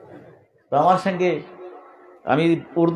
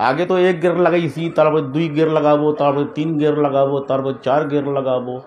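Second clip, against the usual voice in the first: normal talking voice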